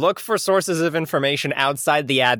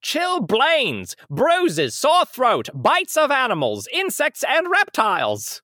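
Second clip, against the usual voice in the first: higher-pitched mid-Atlantic announcer, each item swooping; old school announcer; 8 semitones higher, slower than usual. Acted. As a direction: proclaim each ailment like a vintage ad. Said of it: "old school announcer voice".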